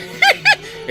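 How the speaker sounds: high, short giggle; giggling laugh. Acted. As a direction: laugh in a quick high burst. Captain laughs among drinkers.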